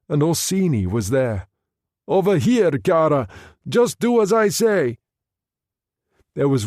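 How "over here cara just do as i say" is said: calling out over the water; raised, urgent command; urgent shout. Acted.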